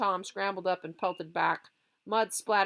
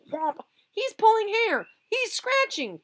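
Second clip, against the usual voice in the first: very high, loud, slow wail; fight yell; 9 dB louder, slower than usual. Acted. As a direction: wail mid-scuffle. Fight outcry.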